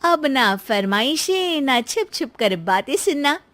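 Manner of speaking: lightly voiced pleased wife; gleeful daughter-in-law. Wife telling husband she is free of demands, gleeful. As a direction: crow over your new freedom.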